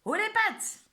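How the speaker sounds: high, loud stick-up yell; robber's shout. Acted. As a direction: bark the hold-up command. Hamster robber holds up mouse.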